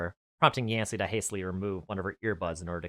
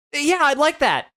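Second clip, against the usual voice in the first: much higher, slow happy reply; smiling; 9 dB louder, slower than usual. Acted. Yancy accepting Nate's call later, smiling voice. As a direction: sound pleased with a smile. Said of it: "Yancy said, smiling".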